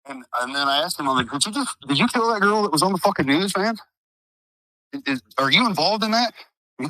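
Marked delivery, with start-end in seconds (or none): 1.3-6.3 retold confrontation. Retold confrontation, demanding an answer.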